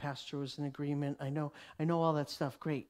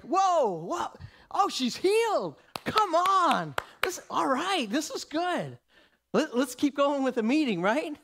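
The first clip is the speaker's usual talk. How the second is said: higher, louder excited burst; amazed father, much higher and louder; psyched; 9 semitones higher, 6 dB louder than usual. Retold or imitated. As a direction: play Jairus thrilled and impatient. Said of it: "he had to be kinda psyched".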